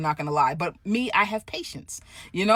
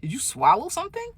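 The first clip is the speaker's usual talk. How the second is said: sassy inner retort; 2 semitones higher than usual. Retold or imitated.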